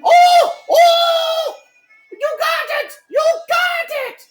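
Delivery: high, delighted cheering; excited. Acted. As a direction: squeal it out with joy. Salesman cheering the player.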